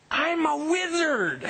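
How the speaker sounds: high, slow mystical declaration; grand reveal. Acted. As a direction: announce it like a secret power. Punchline reveal.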